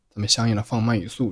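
ordinary talk, nothing marked

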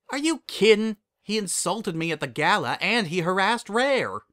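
worked-up country-voiced objection; indignant. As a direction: protest indignantly, pitch jumping up. Applejack objects indignantly to Blue Blood.

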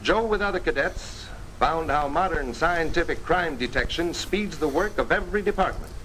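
ordinary delivery

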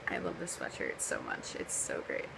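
sick, hoarse, nearly lost voice; rough croaky throat. Real, not acted. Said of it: "I sound like crap".

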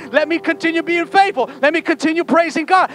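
loud, high, driving exhortation; full-force preaching. Real, not acted. Preacher rousing church to expect traffic on the way, fervent.

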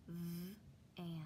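"V-an" whispered, split into two parts; whispered sounding out. Shown as a demonstration. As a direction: breathe "V-an" with a break after the V. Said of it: "What is the word?".

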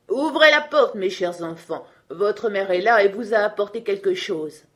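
a rough wolf calling through the door. A narrator's reading. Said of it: "reconnurent le loup à sa voix rude". (loup à la voix rude, plus aigu et plus fort)

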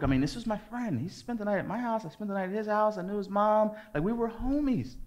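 high-pitched, hurt listing of the friendship; plaintive protest. Retold or imitated. Boy whose best friend broke their friendship, plaintive protest. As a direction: plead it high, as if betrayed.

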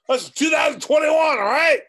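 loud exasperated shout; loud emphatic shout. Real, not acted. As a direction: yell it at them, fed up. Ranting at viewers about the year.

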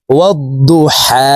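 slowly drawn out, vowel stretched; slow demo. Shown as a demonstration. Teacher shows learners how to lengthen, slow and drawn out. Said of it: "এক আলিফ টেনে পড়ব".

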